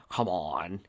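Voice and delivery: low, soft strained grunt; quiet effortful grunt. Acted. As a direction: push it out under the breath. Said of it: "he grunted".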